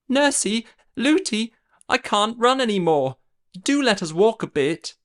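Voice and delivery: put-on high, pleading young girl; tired little princess. A narrator's reading. Princess pleads with her nurse to walk.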